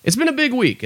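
a squeaky proclamation; high mock announcement. Repeats the line in a new voice.

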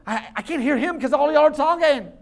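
loud, high, laughing complaint; mock-irritated visitor; annoyed churchgoer. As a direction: gripe half-laughing at the chatter. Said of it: "and you're like".

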